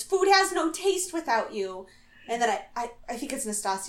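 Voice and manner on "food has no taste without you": high, plaintive imitation; mock lovesick lament, pitched up; Victoria. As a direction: wail it lightly, as a homesick caller. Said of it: "And Victoria goes".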